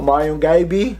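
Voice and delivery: gabi as "guy-bee"; wrong pronunciation. Shown as a demonstration. Teacher showing learners another mistake, gabi as "guy-bee". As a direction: turn the first vowel of gabi into "eye". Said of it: "So don't say".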